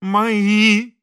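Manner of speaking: high, loud, drawn-out plea; abject insisting. A narrator's reading. Priest abjectly repeating his claim to the chairs, humble. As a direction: insist humbly, stretching the word. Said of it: "униженно твердил отец Фёдор".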